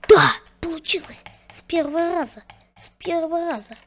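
high whispered joyful cry; excited cheer. Real, not acted. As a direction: cheer the success breathlessly. Trick succeeded.

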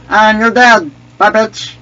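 loud, high victory crow; gloating taunt. Pretend. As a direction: crow over the kill. Gloats over a kill.

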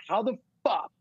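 high, incredulous disbelief; amazed disbelief. Retold or imitated. Retelling his shock to the hosts, high amazed disbelief. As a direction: voice jumps up in stunned disbelief. Disbelief at Charlie knowing the bar.

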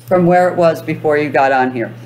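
ordinary delivery

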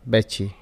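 "بكي" with a ch in place of the k; kaf said as ch. Shown as a demonstration. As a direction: say "بكي" as "bachi", Kuwaiti style. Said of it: "pronounced as cha".

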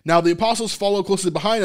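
usual speaking voice

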